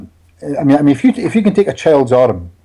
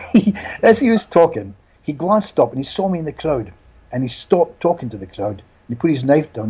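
normal talking voice

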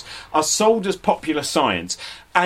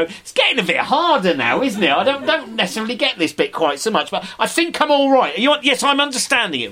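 higher, rising anxiety, pretending he's still following; a confused listener bluffing that he understands; nervous audience member; 7 semitones higher than usual. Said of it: "you go".

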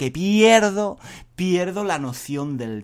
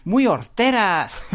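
higher, louder playful teasing of a student; teasing teacher; 8 semitones higher, 4 dB louder, slower than usual. Retold or imitated. Teacher mockingly teasing James about his green shoes. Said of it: "Entonces le digo".

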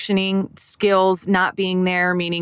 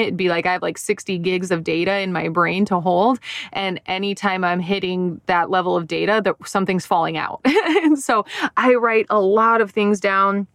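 normal talking voice

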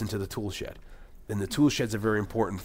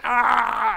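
high, loud mock scream of pain; imitated scream; 12 semitones higher, 7 dB louder than usual. Guy whose arm is chopped off, imitated scream. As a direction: scream like an extra losing a limb. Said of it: "a cutaway of the guy, like".

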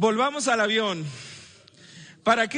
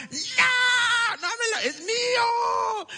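put-on toddler crying shout; 13 semitones higher, much slower than usual. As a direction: wail it like a toddler losing a toy. Toddler's toy taken away.

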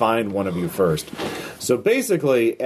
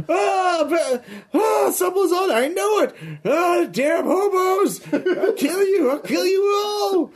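high, slow, laughing villain threat; slower than usual. Acted. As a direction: ham up a cackling madman. GM voices enraged villain.

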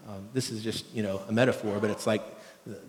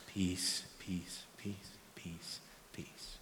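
soft "peace" fading into a whisper; 4 semitones lower, 12 dB quieter, much slower than usual. Shown as a demonstration. Pastor showing his calming walk home, fading to a whisper. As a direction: repeat peace, softer each time until only breath. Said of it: "I'm trying to start going".

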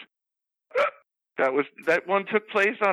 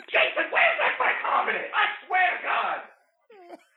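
slow shout; 11 semitones higher, slower than usual. Acted. Character erupts mid-hiccup fit.

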